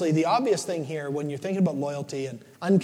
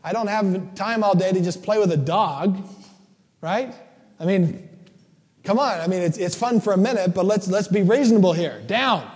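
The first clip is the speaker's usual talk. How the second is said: louder, higher, impatient telling the dog off; fed-up owner; 3 semitones higher, 5 dB louder than usual. Retold or imitated. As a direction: shoo away the clingy dog. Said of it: "finally I'm like".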